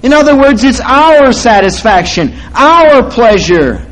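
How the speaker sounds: sermon building to a peak; preaching. Acted. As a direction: drive the point home.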